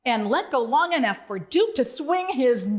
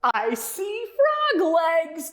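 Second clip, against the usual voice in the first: howling; 5 semitones higher, much slower than usual. Acted. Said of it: "Duke howled".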